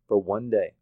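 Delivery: ordinary talk, nothing marked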